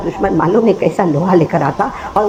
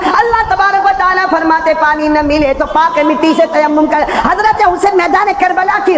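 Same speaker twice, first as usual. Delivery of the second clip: preaching at full force; 10 semitones higher than usual. Acted. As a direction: cry it out to the crowd.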